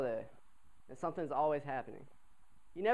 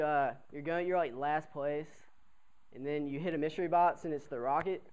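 ordinary talk, nothing marked

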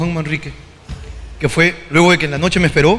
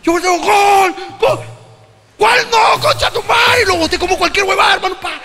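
high, sustained furious yelling; worked-up stadium rant; shouted re-enactment; 14 semitones higher, slower than usual. Retold or imitated. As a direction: relive the outburst, cursing at a high shout. Re-enacts goal and cursing outburst.

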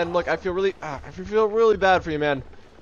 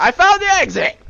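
high, loud cry of discovery; excited shout; 12 semitones higher, 8 dB louder than usual. Real, not acted. Announces discovery mid-sprint.

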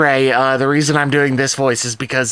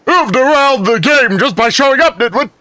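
booming, much higher confident bluster; Gaston voice; 10 semitones higher, 5 dB louder than usual. Acted. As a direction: swagger it out like Gaston. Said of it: "Gaston as best as possible, confident voice".

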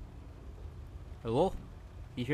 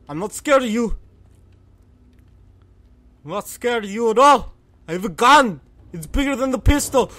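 loud, raised-pitch nervous defiance; scared bravado; 6 semitones higher, 8 dB louder than usual. Real, not acted. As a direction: call out bravely while frightened. Bluffing at unseen threat while frightened.

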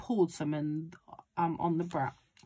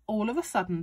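plain reading voice

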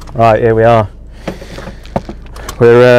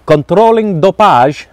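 playful mock Italian accent. Greeting his friend Steve in mock Italian accent. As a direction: ham up broken Italian for a laugh. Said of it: "Italian style".

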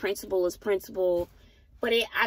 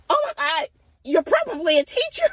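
high, louder mock realization; put-on higher voice, gushing; gushing other driver; 8 semitones higher, 4 dB louder, faster than usual. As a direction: imitate her excited guess.

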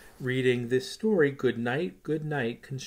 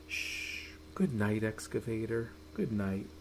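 whispered, much softer goodnight; soft lullaby whisper; hushed goodnight; 2 semitones lower, 6 dB quieter than usual. Acted. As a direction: hush it like a sleeping child is near. Bedtime refrain hushed to sleep.

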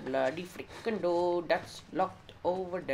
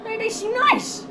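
much higher, slow, sing-song; mock sweet voice; 11 dB louder than usual. Gamer sarcastic about creepy characters, mock sweet voice. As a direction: say it in a mock cutesy voice.

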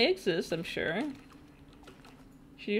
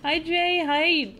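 excited greeting; 7 semitones higher, 7 dB louder, much slower than usual. Real, not acted. Greeting chatters by name.

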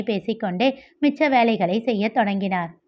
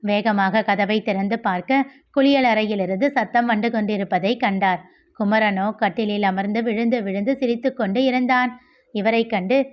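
plain reading voice

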